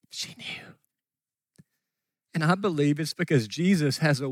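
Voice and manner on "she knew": whispered echo. Shown as a demonstration. Preacher echoing to the church that Mom knew, whispered. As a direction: repeat it in a bare whisper.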